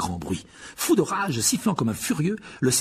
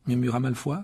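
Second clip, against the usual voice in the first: plain reading voice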